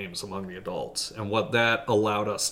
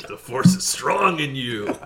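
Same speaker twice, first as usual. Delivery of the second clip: sinister Jedi master voice; 4 semitones higher, 6 dB louder, slower than usual. Acted. Star Wars villain impression.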